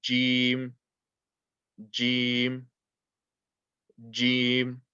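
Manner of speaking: "Jim" with an English J, vowel held; letter jim demonstration. Shown as a demonstration. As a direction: sound out each "Jim" separately, like English J. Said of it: "very similar to the letter J in English".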